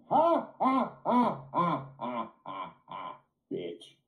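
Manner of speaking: fading taunting ha ha ha; mocking laugh. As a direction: laugh in her face, trailing off.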